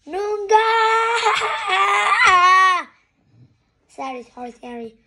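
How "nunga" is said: very loud, high, sustained yell; long screamed war cry. Acted. Harry yelling the password to get in, screaming. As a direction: scream it and hold the last vowel.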